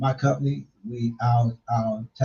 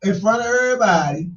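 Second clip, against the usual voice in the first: high-pitched playful accusation; mock blame; 10 semitones higher than usual. Host teasing Cole over his kids, high mock blame. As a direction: blame her in jest, voice pitched way up.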